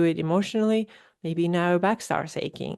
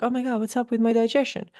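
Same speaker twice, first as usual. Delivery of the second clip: higher, mock-dismayed exclamation; mock alarm; 5 semitones higher than usual. Someone with stomach aches wondering aloud, in mock alarm. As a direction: toss it off like a puzzled hypochondriac. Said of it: "You're like".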